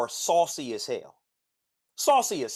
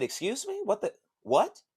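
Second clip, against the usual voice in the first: indignant shock; 7 semitones higher than usual. Retold or imitated. Indignant disbelief on phone.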